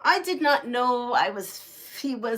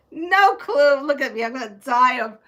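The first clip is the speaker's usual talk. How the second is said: ordinary talk, nothing marked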